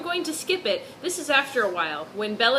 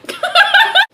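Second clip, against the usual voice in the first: high loud burst of laughter; 13 dB louder than usual. Real, not acted. Co-host cracks up at the fanfic, high laugh. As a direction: crack up at the line.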